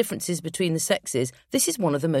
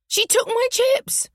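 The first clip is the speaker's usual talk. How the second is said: put-on very high, slow child's voice; childish wail; 4 dB louder, slower than usual. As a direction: wail it like a wronged little boy. Boy wails at sister.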